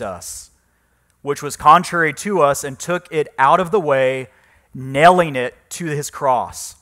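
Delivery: solemn, loud scripture reading from the pulpit. Acted. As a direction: proclaim the verses like a preacher. Reads scripture passage aloud.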